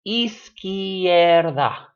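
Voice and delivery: "izquierda" at teaching speed, loud and drawn out; drawn-out syllables. Shown as a demonstration. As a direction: sound out izquierda slowly, syllable by syllable. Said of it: "this word is only three syllables".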